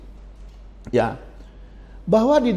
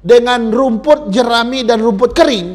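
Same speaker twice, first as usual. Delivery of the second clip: raised, ringing pulpit delivery; loud emphatic sermon warning; forceful preaching; 6 semitones higher, 6 dB louder than usual. Acted. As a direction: preach it out to the congregation.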